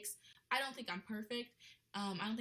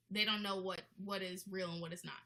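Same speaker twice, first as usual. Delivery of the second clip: ordinary talk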